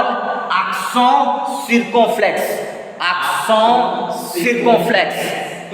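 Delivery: "accent circumflex" said "ak-son seer-kon-flex"; French "accent circumflex" with nasal vowels; French term demo. Shown as a demonstration. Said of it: "this accent we call".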